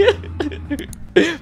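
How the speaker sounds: high burst of laughter; high, amused cackle. Real, not acted. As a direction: laugh out loud at the absurdity. Laughs at the teasing accusation.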